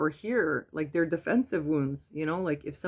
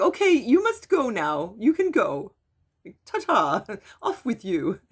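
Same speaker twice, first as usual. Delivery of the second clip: higher, louder, brisk mock-polite shooing voice; imitating mom dismissing; 5 semitones higher, 4 dB louder than usual. Daughter mimics her mother sending her away, higher and louder. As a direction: shoo the visitor off like a sweet old lady, higher. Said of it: "And then she's like".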